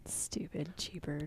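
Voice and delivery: whispered grumble; hushed, breathy scorn. Real, not acted. Whispered complaint.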